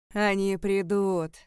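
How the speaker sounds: loud, drawn-out warning cry; thundering. A narrator's reading. Alaric repeating his warning over the crowd, thundering. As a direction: proclaim it slowly, booming. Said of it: "Гремел его голос".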